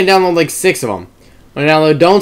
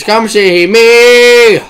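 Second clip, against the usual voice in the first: ordinary delivery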